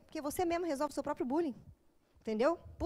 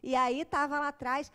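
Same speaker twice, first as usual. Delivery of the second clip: ordinary delivery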